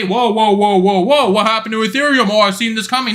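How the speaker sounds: mock gasping "whoa", hammed-up astonishment; sarcastic impression of surprised onlookers; mock amazed crowd. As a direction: play the latecomers stunned by the pump. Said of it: "everyone will say".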